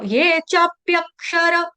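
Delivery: slow syllable-by-syllable Sanskrit; broken up slowly. Shown as a demonstration. Teacher teaching students to read, slow broken-up recitation. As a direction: stretch and separate each syllable. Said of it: "तोड़ तोड़ के पढ़ना".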